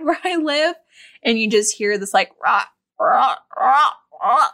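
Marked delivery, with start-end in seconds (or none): 2.1-4.5 croaking frog noises; frog croaks. Retold or imitated. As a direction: imitate frogs croaking rah rah. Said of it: "Those are my frog noises".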